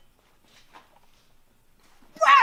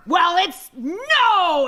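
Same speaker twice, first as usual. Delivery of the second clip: drill sergeant voice; 6 semitones higher, 4 dB louder, much slower than usual. Acted. Sergeant drawing out answer.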